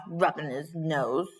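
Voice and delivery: ordinary delivery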